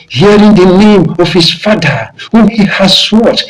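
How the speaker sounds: usual speaking voice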